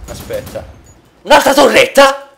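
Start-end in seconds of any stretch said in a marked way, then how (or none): 1.2-2.3 high loud disbelief; outraged by yet another turret; exasperated shout. Real, not acted.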